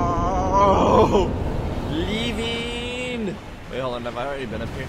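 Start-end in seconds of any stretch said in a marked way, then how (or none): 0.1-3.3 long, high wail of refusal; stretched-out panicked yell; dramatic panic. Real, not acted. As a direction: drag the words out high as you flee. Fleeing a returning enemy.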